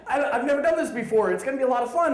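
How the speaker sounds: high, loud, overeager colleague; naive enthusiasm. Retold or imitated. Inexperienced colleague telling the manager about the project, gushing excitedly. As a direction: gush like a clueless keen rookie.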